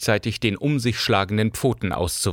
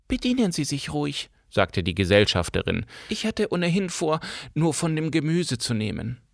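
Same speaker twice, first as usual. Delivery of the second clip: higher, soft, timid voice; meek companion; 5 semitones higher, 4 dB quieter than usual. A narrator's reading. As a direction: stay small and polite. (schüchterne Gesellschafterin)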